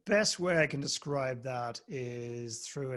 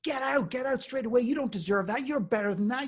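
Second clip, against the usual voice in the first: higher, insistent, pressing advice; urgent fatherly plea; 9 semitones higher than usual. Retold or imitated. Father telling his son to quit the job, urgent and high. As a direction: urge him to get out now.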